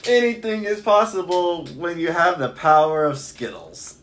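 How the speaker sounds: high, slow heroic declaration; slow, grandiose superhero boast; grand superhero proclamation. Pretend. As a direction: proclaim it like a cartoon hero.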